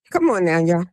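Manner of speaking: ordinary talk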